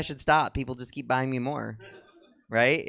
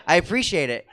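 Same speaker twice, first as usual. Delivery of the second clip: normal talking voice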